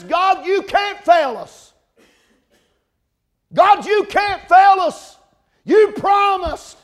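very high, loud, slow pleading shout; young man crying out to God, high, loud and slow; anguished cry to God. Retold or imitated. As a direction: beg God not to fail you.

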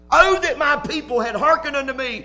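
high, loud, hurt lament; great emotion. Acted. Preacher voicing God's hurt over his people, high and loud. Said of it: "saying this with great emotion".

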